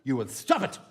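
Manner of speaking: the second warning, now a dark threat; mock menacing parent. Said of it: "is to go".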